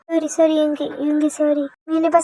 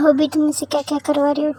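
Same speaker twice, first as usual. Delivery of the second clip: ordinary delivery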